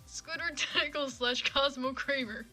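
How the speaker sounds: ordinary talk, nothing marked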